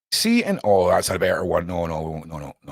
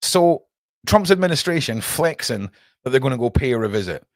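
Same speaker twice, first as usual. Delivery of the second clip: normal talking voice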